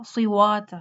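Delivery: "صوات" as siwaat, no r; r becomes w. Shown as a demonstration. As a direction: swap the r for w in "صوات". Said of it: "را کو وا کر دیتے ہیں". ("صوات" را کی جگہ وا)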